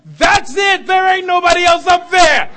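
loud, high, climactic preaching; full-force preaching. Acted. Preacher proclaiming the Spirit already poured out, loud full-force preaching. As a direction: drive it home to the back row.

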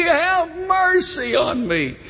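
high, slow wailing plea; crying out, force starting to fade; desperate cry. Acted. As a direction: plead for mercy, drawing out each word. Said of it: "he desperately cries from".

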